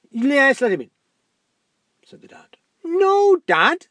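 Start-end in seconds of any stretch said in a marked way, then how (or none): 0.1-0.9 high, loud cheerful dad. Acted. Dad voiced in story. 2.8-3.9 put-on high, loud girl's protest; daughter voice.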